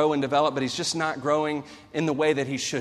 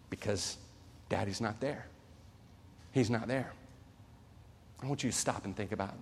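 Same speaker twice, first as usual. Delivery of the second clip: much softer and lower, letting it sink in; hushed; 5 semitones lower, 13 dB quieter than usual. Real, not acted. Preacher to his congregation, a hushed lowered voice. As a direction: drop to a hush over the empty house. Sad sermon point about absence.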